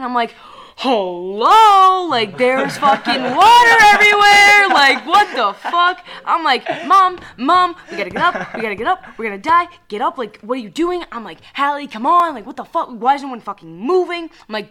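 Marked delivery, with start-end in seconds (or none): 0.8-6.0 high, loud, slow alarmed yell; panicked in a dream while family sits calmly; retold panic. 6.9-11.0 higher, louder pleading with mom; begging mom to flee the flooding boat; retold urgent plea. Urging mom to get up. 11.5-14.2 retold frustrated plea. Urging sister in dream.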